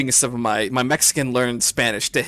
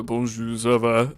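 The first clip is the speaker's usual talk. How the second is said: slightly lowered French greeting; low French voice; 3 semitones lower, 3 dB quieter than usual. Shown as a demonstration. Showing friends his French, a slightly deeper voice. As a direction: drop onto the low notes for French. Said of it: "I hit those low notes".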